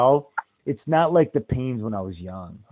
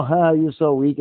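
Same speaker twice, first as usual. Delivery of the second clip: teasing laugh; 4 semitones higher, 7 dB louder than usual. Retold or imitated. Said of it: "he's like".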